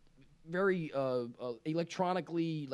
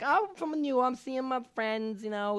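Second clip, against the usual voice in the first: put-on New York accent; 8 semitones higher than usual. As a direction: imitate her thick New York accent, pitched up. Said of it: "really accent".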